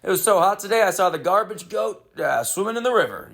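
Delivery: corny joke voice. Shown as a demonstration. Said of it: "I started doing this".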